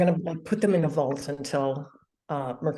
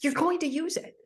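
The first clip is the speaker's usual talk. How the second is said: teasing high voice; 12 semitones higher than usual. Pretend. Teasing friend, squeaky put-on.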